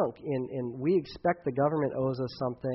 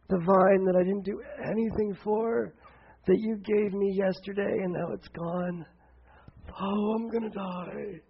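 mope like a sulking prophet; whiny Jonah; 5 semitones higher than usual. Retold or imitated. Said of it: "Jonah's complaint".

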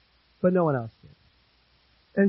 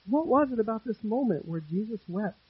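usual speaking voice

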